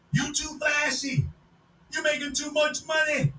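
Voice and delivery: high, slow scolding voice; scolding critics. Retold or imitated. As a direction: nag him slowly from on high. Voicing old critics of Johnson.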